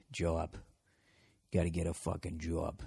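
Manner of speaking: low, soft Boston accent, "jaw" vowel in "job". Shown as a demonstration. Host coaching Boston accents, quiet Boston demo. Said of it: "working on your Boston accents".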